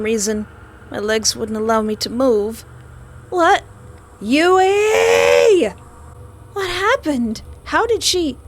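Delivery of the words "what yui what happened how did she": high, loud startled cries; shocked disbelief. Real, not acted. Player staring at friend's body, shrill shocked questions. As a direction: blurt out in horror.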